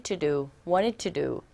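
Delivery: "wanted to do" as "waned tu du", T dropped after N; softened T in wanted. Shown as a demonstration. Teacher contrasts the reduced form for the class. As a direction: run "wanted to do" together, no hard T. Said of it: "wanted to do가 아니라".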